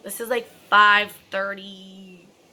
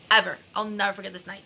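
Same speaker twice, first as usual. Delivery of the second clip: ordinary delivery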